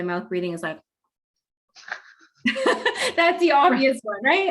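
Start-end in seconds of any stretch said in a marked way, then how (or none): 2.4-3.1 sudden high laugh; burst of laughter. Real, not acted. Laughs at mouth-breather image. 3.1-4.5 laughing. Real, not acted. Laughing at own imitation.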